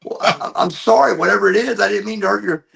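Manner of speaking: high, loud, pleading retelling of his text; imitating a hurt friend. Friend's hurt text read back to the storyteller, high and pleading. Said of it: "oh, he goes".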